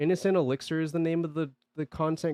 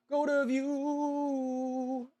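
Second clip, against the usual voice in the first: high robotic voice; 12 semitones higher, much slower than usual. Acted.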